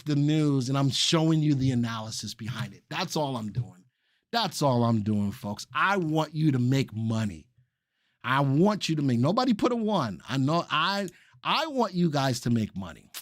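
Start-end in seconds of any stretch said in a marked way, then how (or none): none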